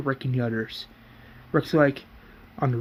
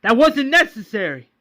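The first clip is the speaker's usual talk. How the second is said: furious high, loud outburst; snapping; 9 semitones higher, 6 dB louder than usual. Acted. Roland confronts Philip over shooting Eileen, snapping angrily. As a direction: explode in outrage, pitch leaping up. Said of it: "then Roland snaps".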